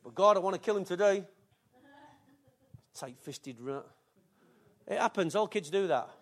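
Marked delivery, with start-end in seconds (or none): 2.9-3.8 mock grumble. Teen insulting stingy dad.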